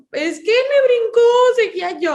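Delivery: put-on high, loud whiny child's complaint; a hint of a sobbing child; mock crying child. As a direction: imitate a tearful little kid tattling. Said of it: "llore y diga".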